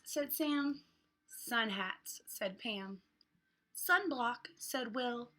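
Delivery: lively picture-book read with voiced characters; reading to children. Teacher reading a beach book aloud to kids, lively. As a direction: read it like story time for little ones.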